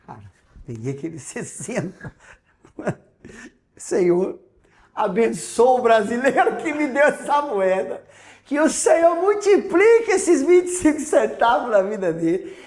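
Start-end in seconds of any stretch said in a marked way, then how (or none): 3.8-12.6 higher, louder, laughing thanks; joyful prayer. Real, not acted. Thanking God for the coin, higher and louder with laughter. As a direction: bless him with laughing joy.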